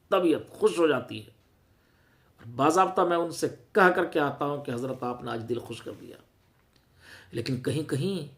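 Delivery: ordinary delivery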